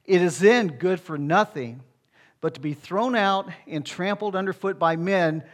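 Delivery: ordinary talk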